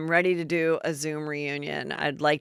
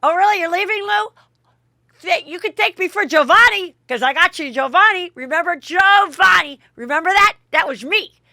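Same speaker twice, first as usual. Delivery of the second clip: loud, much higher mock tirade; drunk outburst; 12 semitones higher, 8 dB louder than usual. Dorinda yelling at Luann as she leaves, loud drunken tirade. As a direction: rant as a drunk, wounded friend. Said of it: "And she goes".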